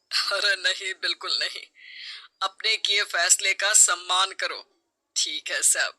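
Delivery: calm her down; reassuring husband. Acted.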